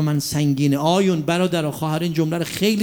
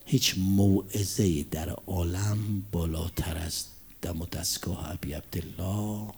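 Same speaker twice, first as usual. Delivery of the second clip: soft, whispered, slowed pulpit voice; hushed sermon close; 7 semitones lower, 6 dB quieter than usual. Real, not acted. Preacher closing the sermon before mourning Imam Hussein, hushed. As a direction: draw them in with a near whisper.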